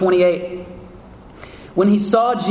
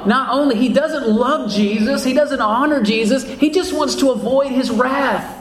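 higher-pitched preaching fervor; impassioned preaching; 6 semitones higher than usual. Real, not acted. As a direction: drive the point home from the pulpit, pitch raised. Preacher builds to a heated point.

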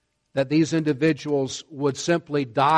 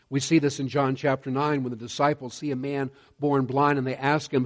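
ordinary delivery

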